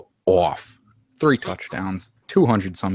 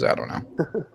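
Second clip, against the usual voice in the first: unvoiced mock-doubt aside; whispered aside; 9 semitones higher, 6 dB quieter, slower than usual. Real, not acted. Host needling a co-host's weak week, whispered. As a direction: drop to a whisper.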